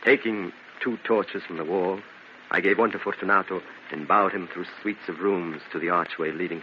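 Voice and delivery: low, soft storyteller voice; hushed story narration. A narrator's reading. [name] narrates the walk down to the catacombs, low and soft. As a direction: narrate the descent quietly.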